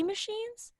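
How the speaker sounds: high teasing echo, mocking the mockery; mock disbelief echo. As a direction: toss the word back in high mock surprise. Friend echoes the mockery back.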